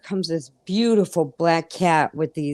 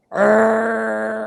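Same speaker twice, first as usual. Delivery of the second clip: imitated cat purr; 4 semitones higher, 4 dB louder than usual. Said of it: "it started going".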